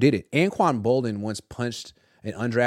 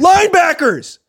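loud high burst of disbelief; outraged exclamation; 10 dB louder, slower than usual. Real, not acted. Reporter echoing his cohost in outrage. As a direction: echo it back in indignant shock. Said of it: "go against linebackers".

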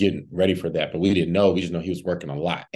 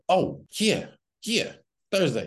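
high-pitched, halting agreement; retold reply, pitched way up; put-on voice; 10 semitones higher, slower than usual. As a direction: squeak out a hesitant yes. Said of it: "You did your voice".

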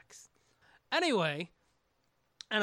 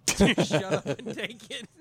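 laughing; 11 dB louder, slower than usual. Real, not acted. Repeating the joke, laughing.

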